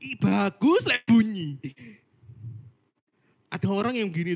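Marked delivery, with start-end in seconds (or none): 0.0-1.6 slow, loud mocking put-on voice. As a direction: sneer it out slowly. Mocking a lazy worshipper. (ejekan lambat dengan suara dibuat-buat)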